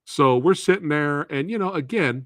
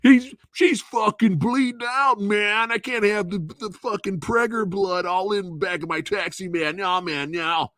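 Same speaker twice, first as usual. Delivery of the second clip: high, rough-edged mock cabbie panicking; taxi driver voice; 9 semitones higher than usual. Narrator voicing the taxi driver refusing the bleeding wife, high panicky cabbie voice. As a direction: play the cabbie freaking out, way up high. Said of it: "That's the voice I'm giving him".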